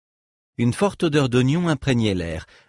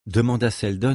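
plain reading voice

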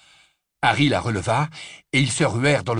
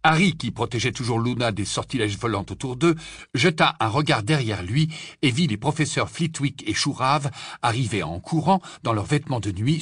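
ordinary delivery, nothing marked

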